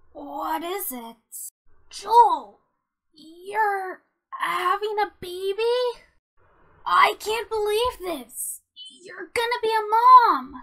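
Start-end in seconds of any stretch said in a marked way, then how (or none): 1.9-10.5 loud, high, delighted disbelief; thrilled exclamations at happy news; overjoyed surprise. Acted. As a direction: build from astonishment to a joyful shout. Learns friend is pregnant.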